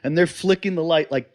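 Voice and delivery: ordinary talk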